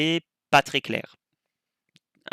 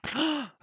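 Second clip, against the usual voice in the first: sharp gasp; 6 dB quieter than usual. Real, not acted. Realizes he forgot to shake.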